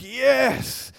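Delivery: loud high cheer; excited. Pretend. Owner offered a magic collar, loud joyful yes. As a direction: punch the air with a yes. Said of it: "And you're like".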